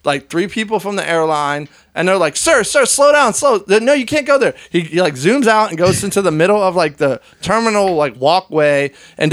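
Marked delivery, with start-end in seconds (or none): 2.4-4.5 high, loud mock call after him. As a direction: yell after a runaway, imitating staff. Staff chasing man on scooter.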